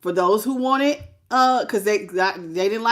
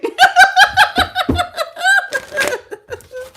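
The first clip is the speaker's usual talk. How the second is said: long high squealing laugh; high delighted laugh; 5 dB louder than usual. Real, not acted. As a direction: burst out laughing with glee. Delighted by the cards.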